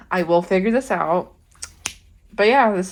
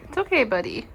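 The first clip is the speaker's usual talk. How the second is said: soothing a scared dog; 7 semitones higher, 6 dB quieter than usual. Real, not acted. Comforting a nervous rat.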